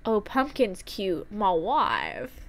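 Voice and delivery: usual speaking voice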